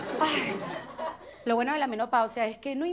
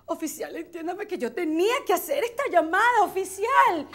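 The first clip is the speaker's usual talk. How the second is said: pleading driver; 5 semitones higher, 4 dB louder than usual. Acted. Pleading with officer.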